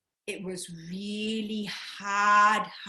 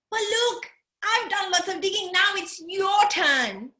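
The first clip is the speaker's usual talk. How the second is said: proud digger; 8 semitones higher, 4 dB louder than usual. Acted. Tree kangaroo calling koala to dig.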